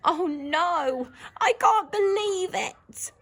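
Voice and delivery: a worried inner cry; shocked disbelief. Shown as a demonstration. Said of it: "she's sort of thinking in her head".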